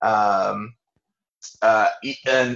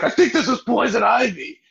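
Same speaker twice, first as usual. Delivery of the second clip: high put-on whiny Polly voice; mock Polly; 11 semitones higher than usual. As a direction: play the panicked henchman, pitched up. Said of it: "he's like".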